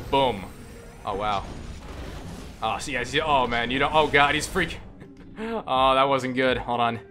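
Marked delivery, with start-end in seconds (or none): none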